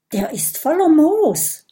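high, wide-eyed wonder; a child dragon marvelling at the moss; amazed. Acted. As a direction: gasp it out in surprise. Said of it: "staunt Kokosnuss".

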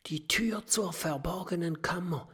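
hushed storybook discovery; whispering. A narrator's reading. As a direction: whisper it in awe. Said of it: "flüstert Kokosnuss".

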